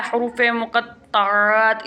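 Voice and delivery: हरूफ मुक़त्तआत drawn out syllable by syllable; slow term. Shown as a demonstration. Teacher introduces the lesson's term to students, slow and deliberate. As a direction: spell out मुक़त्तआत slowly, each part clear. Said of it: "हरूफ मुक़त्तआत कुरान पाक की".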